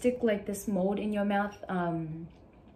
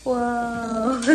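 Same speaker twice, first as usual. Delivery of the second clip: amazed whoa; 7 dB louder than usual. Real, not acted. First sees teeth without braces.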